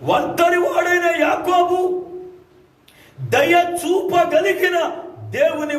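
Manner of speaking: slow, high, loud proclamation; preaching at full force. Acted. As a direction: stretch each word out over the crowd.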